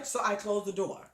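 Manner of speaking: normal talking voice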